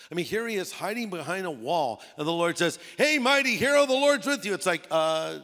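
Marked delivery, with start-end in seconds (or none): 3.0-4.5 high, loud greeting; hailing call. Acted. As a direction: call out to someone in hiding. Lord hailing a hiding Gideon.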